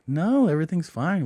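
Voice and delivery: hint of a young royal; royal daughters. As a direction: reassure in a mock high voice. Retells the royal daughters' reassurance.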